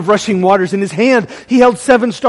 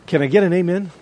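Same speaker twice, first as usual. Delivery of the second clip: ordinary talk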